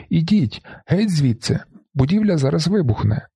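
high, urgent warning yell; shout. A narrator's reading. Narrator warning the crowd off the roof, shouting. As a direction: shout them away from danger. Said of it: "Кричу".

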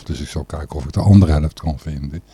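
ordinary talk, nothing marked

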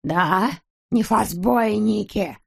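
slow, disbelieving grumble with F for R; sceptical grumble. A narrator's reading. Miser doubts the honest businessmen, sceptical grumble. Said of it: "проворчал Скуперфильд".

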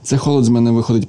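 usual speaking voice

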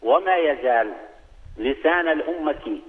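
ordinary talk, nothing marked

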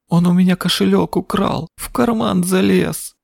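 high, tearful wail; sobbing. Acted. Boy sobs to the woman about the theft. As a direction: cry it out like a robbed child. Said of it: "сквозь рыдания выдавил Лок".

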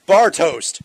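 a loud, proud name reveal; loud announcement. Real, not acted. Proposing a name loudly.

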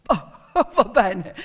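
slow chuckle through the words; laughing. Acted. Director laughing as he accepts Nellie's deal, amused.

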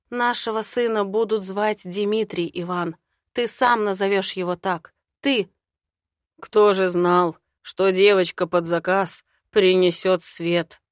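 plain reading voice

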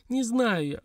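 high-pitched exasperated outburst; mild yell. A narrator's reading. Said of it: "заорала Лилька". (несильный крик на высоком тоне)

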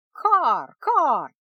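loud, teasing caw; mock his name like a crow. A narrator's reading. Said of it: "передразнила она".

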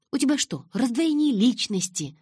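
high, loud exasperated retort. Acted. Narrator challenging Калин's changing moods, exasperated. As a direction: challenge him, pitch and volume up. Irritated retort in acted quarrel.

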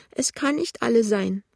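put-on high, fretful old woman; anxious. A narrator's reading. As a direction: ask it in a high, worried whine. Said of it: "sagt die klagende Stimme". (ängstlich, hohe klagende Stimme)

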